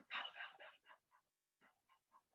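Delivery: breathy whispered rowing chant; whispered paddling. Pretend. Teacher miming Aesop rowing, whispered paddle chant. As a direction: whisper each stroke softly.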